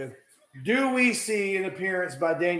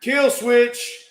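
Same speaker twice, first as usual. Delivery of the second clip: excited call; 6 semitones higher, 5 dB louder than usual. Real, not acted. Calling finisher during match.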